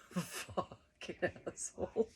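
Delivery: breathy, slow mock mutter under the breath; muttered insult. Laughing at herself, muttering the insult softly.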